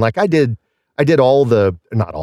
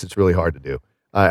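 ordinary talk, nothing marked

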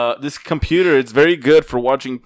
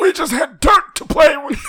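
put-on old man voice; 10 semitones higher than usual. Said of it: "talk like an old man".

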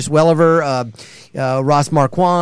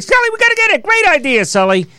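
much higher, excited little monster voice; Mike Wazowski. Retold or imitated. Voice actor showing how he studied the cartoon, high monster voice. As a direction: squeak it out like the one-eyed monster. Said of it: "started watching more Mike Wazowski".

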